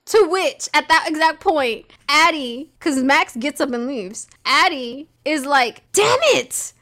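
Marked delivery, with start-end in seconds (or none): 5.9-6.7 exasperated yell. Acted. Addie snaps at Luke.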